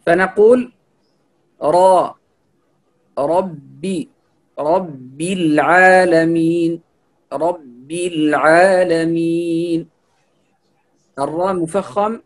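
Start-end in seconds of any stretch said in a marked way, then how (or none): 1.6-4.1 را thick, then ربي with doubled light b; heavy ra. Shown as a demonstration. Teacher models each part, heavy ر and doubled ب in ربي. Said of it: "هنا تفخيم الراء". 4.6-9.9 رب العالمين slowly, thick ر and held light ب; heavy ra, doubled ba. Shown as a demonstration. Teacher models for learners, slow recitation of رب العالمين with heavy ر. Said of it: "وتشديد الباء".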